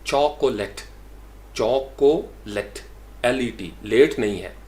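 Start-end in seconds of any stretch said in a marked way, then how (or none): 0.1-2.8 चॉकोलेट slowly as CHAW-ko-let; three deliberate syllables of चॉकोलेट; open AW in first syllable. Shown as a demonstration. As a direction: sound out चॉकोलेट, long CHAW, short let. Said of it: "इसको हम पढ़ते हैं चॉक".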